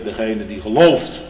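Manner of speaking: the Lord answering the father; proclaiming Lord. Acted. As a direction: announce it with authority. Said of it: "zegt de Heere".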